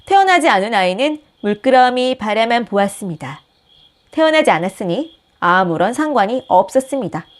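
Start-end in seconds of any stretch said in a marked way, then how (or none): none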